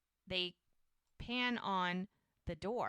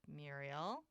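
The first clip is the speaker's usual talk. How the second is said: "Muriel" said very softly, low, rising at the end; gentle questioning name; 4 semitones lower, 10 dB quieter than usual. Retold or imitated. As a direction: say "Muriel" as a quiet, careful question. He leans in, says her name.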